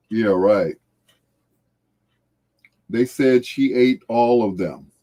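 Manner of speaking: ordinary talk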